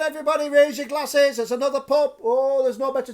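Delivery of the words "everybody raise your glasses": raised, higher toast to everybody; toast call. Real, not acted. Host toasting viewers, calling out loud and high. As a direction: call the room to raise glasses.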